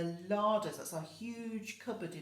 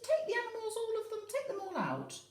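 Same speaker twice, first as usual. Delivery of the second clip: put-on wise old man; faster than usual.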